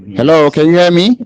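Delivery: across the street. Real, not acted. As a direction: call out over a bad line. Caller can't hear host.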